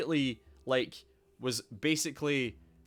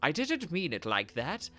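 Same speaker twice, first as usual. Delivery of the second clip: reassuring advisor; 6 semitones higher than usual. Acted. Advisor reassures god in dialogue.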